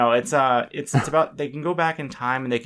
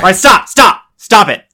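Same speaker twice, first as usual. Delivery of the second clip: loud, high sudden shout; mock scare shout; 7 semitones higher, 13 dB louder than usual. Host yelling at cohost to startle him, loud and high. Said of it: "I was trying to scare you".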